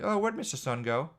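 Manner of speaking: put-on high, puzzled toddler voice; imitating toddler son. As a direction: ask it like a confused little kid. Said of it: "Then it's cloudy".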